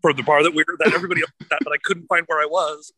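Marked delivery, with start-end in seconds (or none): none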